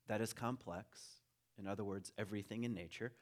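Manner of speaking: normal talking voice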